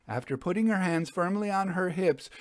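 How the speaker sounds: ordinary delivery, nothing marked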